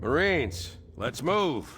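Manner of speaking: commanding call to move out; calling out orders. Acted. Officer rallies troops to move out.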